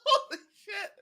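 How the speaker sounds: high laughing cry; laughing shout. Real, not acted. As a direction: crack up in disbelief. Reaction to big move.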